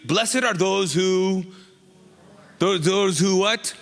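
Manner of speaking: slow, drawn-out prompt waiting for the congregation; coaxing the crowd. Shown as a demonstration. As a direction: stretch it out so they fill in the blank. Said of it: "help me out, what?".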